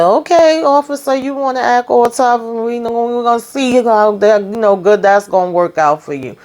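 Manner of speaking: high, singled-out taunt at a tough guy; high-pitched mocking aside; mocking warning. Real, not acted. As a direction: pitch it high, sceptical of the bravado. Mocking reaction to the tough officer.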